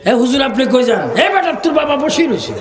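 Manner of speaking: mock alarmed call, rising high and quick; high, hurried retold outcry; retold parents' cry. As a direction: call out like startled parents, in jest. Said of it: "বাবা মা চিৎকার দিয়ে".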